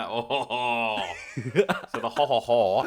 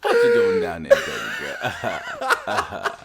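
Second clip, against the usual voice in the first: high, loud, drawn-out sleazy bro; hammed-up frat boy calling out; 6 dB louder, slower than usual. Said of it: "A TikToker fuckboy laugh, like a frat boy".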